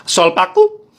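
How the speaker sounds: high, loud, rising garbled call; cobbler's street cry. Retold or imitated. Roving shoe repairer calling for work, high rising cry. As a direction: sing out "paku" upward. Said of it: "dia keliling sambil bilang".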